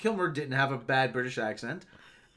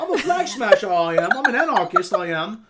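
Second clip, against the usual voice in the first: put-on high, loud, jeering rough street accent; mock rough street accent; 4 semitones higher, 7 dB louder than usual. Mocking the actress's anarchist role, rough street accent. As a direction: strut through it in her rough street accent. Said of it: "that's how she kinda sounds".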